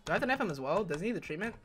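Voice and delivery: normal talking voice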